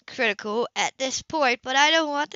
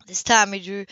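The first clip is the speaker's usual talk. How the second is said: ordinary delivery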